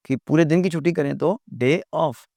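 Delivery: usual speaking voice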